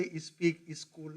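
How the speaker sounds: "Is speak, is school" with an extra i before each s; UP English accent. Shown as a demonstration. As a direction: add a short "is" onset, as in "ispeak, iskool". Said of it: "You go to U P, they say".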